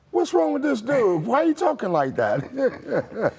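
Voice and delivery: mock-baffled, high-pitched quip; incredulous friends. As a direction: tease him like a puzzled buddy. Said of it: "my friends and partners would say".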